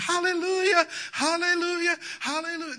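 high, loud mock hallelujahs; shrill-pitched fake praise shout; mock church screamer. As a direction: parody the overexcited churchgoer. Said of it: "They come in and scream".

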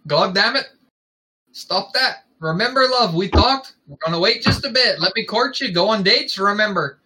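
high-pitched Scottish character voice; Scottish accent. A narrator's reading. He reminds his love to wait, in a Scottish accent. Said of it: "Ron doesn't have an accent like that".

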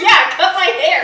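laughing while quoting. Retold or imitated. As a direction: giggle through the quote. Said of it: "she was like".

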